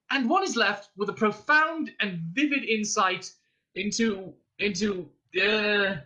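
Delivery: high grand flourish, then stuck; crescendo giving out. A narrator's reading. Arthur's triumphant critique runs out, grand then faltering. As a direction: soar confidently, then stall and grope. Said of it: "which suddenly gave out on him".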